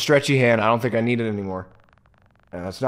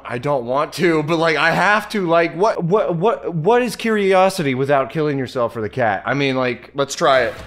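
nervous excitement; 6 semitones higher, 6 dB louder than usual. Real, not acted. Nervously going under, scared.

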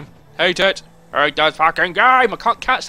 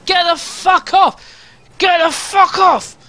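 much higher, louder, slowed, repeated shouts; furious screaming; 9 semitones higher, 6 dB louder, slower than usual. Real, not acted. Gamer screaming at a car in the road, furious screaming. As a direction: scream at the car blocking you.

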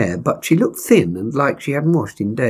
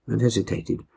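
usual speaking voice

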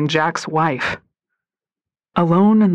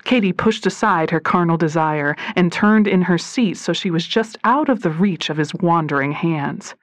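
ordinary delivery

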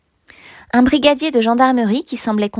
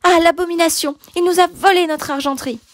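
high, indignant exclamation; outraged alarm; 8 semitones higher than usual. Acted. Housekeeper tells the bishop of the theft, outraged. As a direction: cry out in dismay at a higher pitch. Housekeeper discovers silver is stolen.

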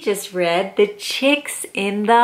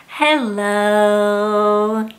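ordinary delivery, nothing marked

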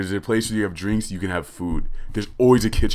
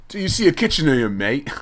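mocking British echo; 6 semitones higher, 3 dB louder, faster than usual. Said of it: "and I'm like".

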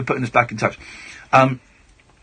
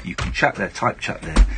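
ordinary delivery

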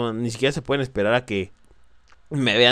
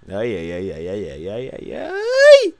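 mock wail; 8 dB louder, slower than usual. Scared gamer wailing ay ay ay.

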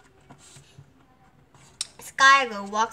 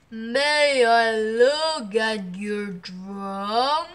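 very slow, drawn-out elephant voice; 4 dB louder, much slower than usual. A narrator's reading. Elephant asks to see the drum, slow big voice. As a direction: ask it big and lumbering, stretching every word. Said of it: "asked the elephant".

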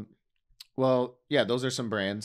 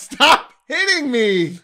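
laughing protest; 10 semitones higher, 10 dB louder, slower than usual. Real, not acted. Laughing while being hit.

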